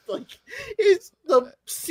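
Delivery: high, slow words through tearful laughter; laugh-crying. Real, not acted. Friend overcome with laughter remembering a scene. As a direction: squeal it while cracking up.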